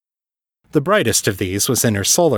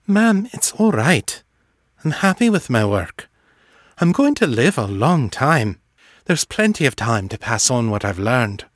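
reassuring daughter; 2 semitones higher than usual. Acted. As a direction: reassure him in a lifted, feminine voice.

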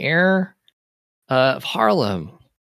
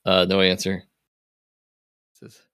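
ordinary delivery

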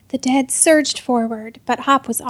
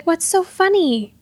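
indignant, higher-pitched question; affronted, raised pitch; demanding; 6 semitones higher, 3 dB louder than usual. A narrator's reading. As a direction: demand to be let in on it. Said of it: "Hop demanded".

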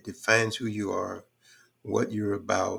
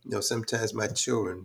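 normal talking voice

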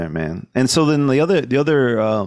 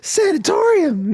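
much higher, strange manic voice; acting crazy. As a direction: play a twitchy madman trying to scare off a thug. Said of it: "get really nutty and be like".

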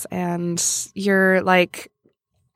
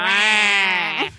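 Waluigi wah. Acted. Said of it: "Three, two".

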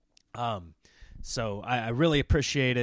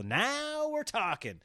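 high, stretched-out "now"; drawn-out mock triumph; playful relish; 11 semitones higher, 4 dB quieter, much slower than usual. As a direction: savor it in a slow, raised croon. Celebrates bigger venue in acted thought.

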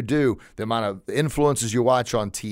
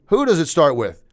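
rallying question; 4 semitones higher, 5 dB louder than usual. Real, not acted. Repeats question, rallying listeners.

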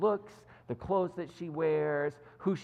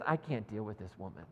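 low, soft disgusted mutter; a quiet put-off aside under the breath; 8 semitones lower, 7 dB quieter than usual. Retold or imitated. Rebecca walking away, quietly disgusted. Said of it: "walks away in disgust".

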